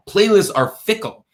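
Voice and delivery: ordinary delivery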